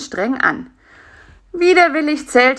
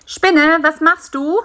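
higher, curious little question; lightly pitched-up character asking a question; lightly voiced fly; 9 semitones higher, 6 dB louder than usual.

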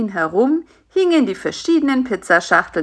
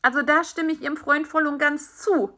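beetle chiming in; 8 semitones higher than usual. Acted. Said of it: "mischte sich der Käfer ein".

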